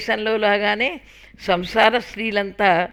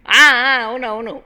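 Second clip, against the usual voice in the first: mock agreement, higher and louder; teasing; 5 semitones higher, 9 dB louder than usual. A narrator's reading. Husband teasing his mother with mock agreement. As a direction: playfully agree to tease her. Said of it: "నవ్వుతూ వాళ్ళమ్మని కాసేపు ఏడిపించడానికని".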